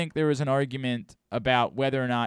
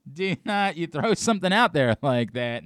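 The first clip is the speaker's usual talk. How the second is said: ordinary delivery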